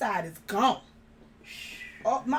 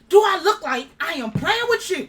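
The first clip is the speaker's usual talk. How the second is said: indignant rebuke; 5 semitones higher, 5 dB louder than usual. Retold or imitated. Rebuking father who thinks it's joke.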